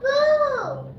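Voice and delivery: crying. Real, not acted. Child upset over his food.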